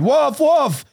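very high-pitched mock shout of alarm; mock cry for help. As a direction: cry wolf in drawn-out high calls. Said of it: "then the boy cried".

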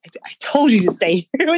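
loud told-you-so scolding; exasperated wife scolding injured husband, raising her voice. Retold or imitated. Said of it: "it's like".

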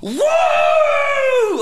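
long, very high shouted woo; celebratory whoop. Retold or imitated. Celebrating a hundred bucks saved a month, high mock whoop. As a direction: let out a hammed-up high cheer.

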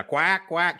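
playful duck noise quacks. Pretend. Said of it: "I'm gonna do a duck noise now".

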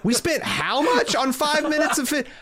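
high, outraged studio exec yelping about the budget; shrill budget panic, voice climbing; panicked producer. Acted. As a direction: play a frantic money man, pitch way up.

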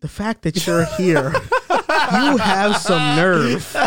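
slow disbelieving scold, laughing; mock scolding. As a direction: drag it out through laughter like an indignant manager. Manager scolding him at Target.

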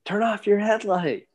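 high-pitched mock yell called back down the trail; half-shouted warning, pitched high; retold yell. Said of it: "He's yelling back at me".

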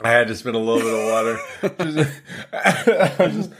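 high, laughing through the words; can barely talk for laughing. Real, not acted. Friend who spat water laughing through explanation.

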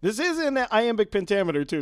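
normal talking voice

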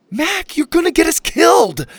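loud, high panicked plea; frantic cry. Acted. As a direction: cry out in terror. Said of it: "Lainey cried".

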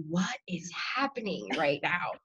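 slow, hushed disbelief; re-enacted frozen astonishment; stunned bafflement. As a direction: spell out your bafflement slowly and softly. Parents frozen mid-bite in bafflement.

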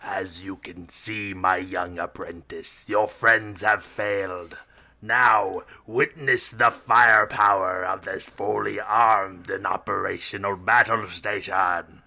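slow, menacing evil emperor voice; drawn-out sinister villain impression; Emperor Palpatine. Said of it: "That's the first person you have to impersonate".

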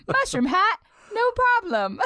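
very high, loud cartoon plumber; hammed-up Italian video-game hero; Mario. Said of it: "It's like Mario".

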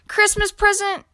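high, loud incredulous echo; scornful snort. A narrator's reading. Grumpy Badger scoffs at Squirrel's gift, snorting. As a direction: repeat it with offended disbelief. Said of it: "snorted Grumpy Badger".

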